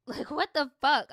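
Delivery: loud laughing outburst of disbelief. Real, not acted. Reacting to her story with a loud laughing disbelief.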